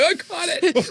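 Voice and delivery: high, excited "I caught it"; playful high excitement; mock triumphant. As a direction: pounce on it gleefully. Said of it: "I'm like".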